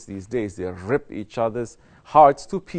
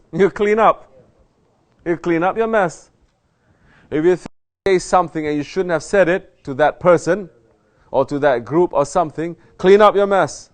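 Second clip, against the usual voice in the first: raised, emphatic sermon voice; forceful preaching; 7 semitones higher, 8 dB louder than usual. Acted. Sheikh urging murids to own mistakes, forceful preaching. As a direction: hammer the lesson home to the congregation. Preacher drives home point.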